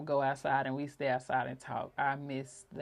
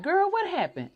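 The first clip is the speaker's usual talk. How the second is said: put-on high, loud girl what happened; eager question; 7 dB louder than usual. Answering her sister in the dream, high and eager. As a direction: ask it eagerly. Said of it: "And I said".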